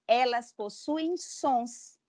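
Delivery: ordinary talk, nothing marked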